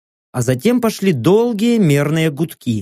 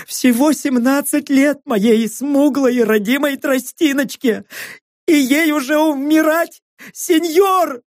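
sobbing plea; 9 semitones higher than usual. Acted. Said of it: "Я всхлипнул, шмыгнул носом".

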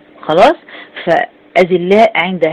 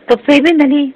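higher-pitched, mildly aggrieved question; acted plea, pitch lifted well above usual; pleading protest; 8 semitones higher, 3 dB louder, much faster than usual. Hypocrites calling out to the believers they walked with, pleading protest. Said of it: "ينادونهم".

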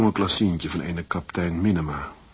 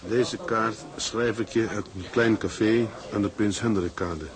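letter reading. Acted. Husband reading his farewell card to his wife. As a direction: read the postcard aloud tenderly.

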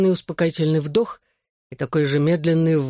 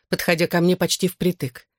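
usual speaking voice

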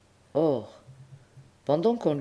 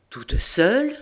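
put-on alarmed old pope; 9 semitones higher, 5 dB louder, slower than usual.

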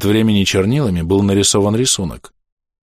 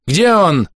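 high-pitched shouted question; крик; 9 semitones higher, 3 dB louder than usual. A narrator's reading. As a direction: demand it more urgently. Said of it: "закричал врач". (требовательный крик высоким голосом)

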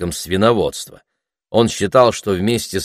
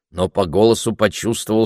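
ordinary delivery, nothing marked

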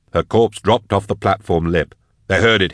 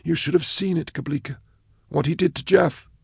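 higher, softer nervous voice; Blake's voice; 10 semitones higher, 5 dB quieter than usual. A narrator's reading. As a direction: keep Blake's thin, fearful tone. Blake's character voice.

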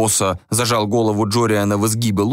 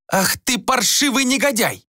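high-pitched, furious woman's cry; acted shriek at a scoundrel; outraged yell. A narrator's reading. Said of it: "завопила она".

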